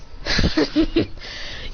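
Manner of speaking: acted scornful snicker; villain's chuckle. Villain laughs at the hero.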